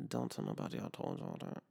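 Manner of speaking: hushed confession to the viewers; secretive aside. Real, not acted.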